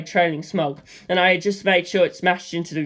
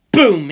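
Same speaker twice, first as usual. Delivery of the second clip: louder punchy boom; mouth-made blast; explosion boom; 8 dB louder than usual. A narrator's reading. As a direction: blurt the explosion out. Explosion sound in story.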